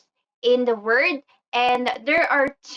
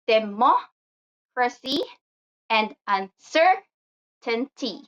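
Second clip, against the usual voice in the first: "democracy and uncertainty", very slowly, stressing MOC and CER; stress on third-last syllable; slower than usual. Shown as a demonstration. Teacher demonstrates the rule, slow stressed MOC and CER in "democracy and uncertainty". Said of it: "stress the third from the last syllable".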